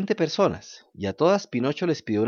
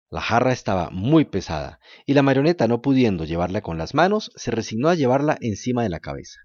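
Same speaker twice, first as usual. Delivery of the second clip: ordinary delivery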